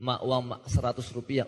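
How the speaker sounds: low, soft wheedling for money; imitated child begging mother. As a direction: mumble a sheepish request to mum. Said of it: "Waktu itu kan dari orang tua".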